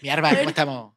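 a hint of Andalusian accent; playful Andalusian-accented greeting. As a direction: greet them like a Sevillian. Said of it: "puedo medio imitar son el andaluz".

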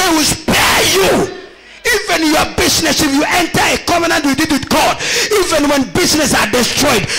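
fervent sermon delivery over music; preaching. Acted. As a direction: preach it out to the congregation. Preacher exhorting.

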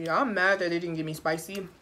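usual speaking voice

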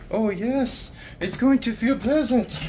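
high, airy mock-delighted voice; mock cheerful. Mocking how a relaxed client should sound before a massage, high and airy. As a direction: play the eager spa client, up high. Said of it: "You should be like".

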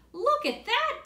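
high, loud and thrilled; excited boy. Acted. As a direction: call out amazed at the fallen tree. Boy excited by fallen tree.

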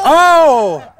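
sudden startled oh; surprised exclamation. Real, not acted. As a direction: blurt it out in surprise. Tug of war suddenly ends.

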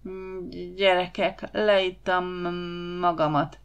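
put-on slow, halting drunken slur; stammering mock drunk. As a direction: play drunk, dragging out the words. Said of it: "dadogva szólt".

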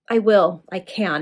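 plain reading voice

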